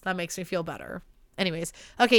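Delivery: ordinary talk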